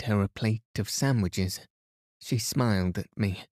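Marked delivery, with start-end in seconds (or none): none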